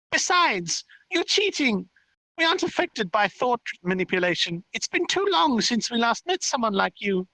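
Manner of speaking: high, squeaky fairy voice; complaining little fairy, accusing. Acted. Fairy objecting to the narrator cheating, squeaky and accusing. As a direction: pipe up in accusation like a tiny fairy.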